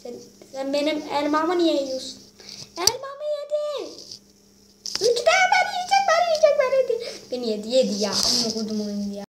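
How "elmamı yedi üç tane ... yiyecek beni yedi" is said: much higher, squealing protest; squealing high whine. Real, not acted. Kid gamer squeals at viewers as he's eaten, high whine.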